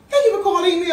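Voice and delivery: cheery scripted phone greeting; call center greeting. Retold or imitated. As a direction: sing out the greeting like a call agent. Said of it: "you would be like".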